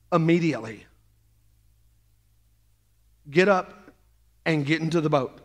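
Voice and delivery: low, curt order from Jesus; blunt command. Retold or imitated. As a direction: snap it like a terse command. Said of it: "I don't like that tone".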